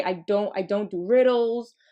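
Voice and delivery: usual speaking voice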